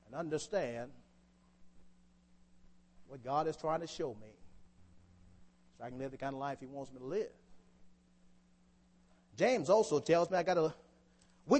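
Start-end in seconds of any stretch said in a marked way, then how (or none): 0.0-7.3 low, very soft voice; hushed reflection. Real, not acted. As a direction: drop right down after the climax, almost to yourself. Preacher drops down after the climax.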